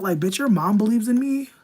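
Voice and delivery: loud, high, thrilled burst; excited. Real, not acted. Thrilled about a compliment.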